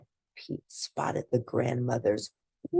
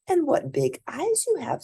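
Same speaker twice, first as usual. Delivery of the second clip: put-on little girl voice. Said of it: "said Little Red Riding Minnie".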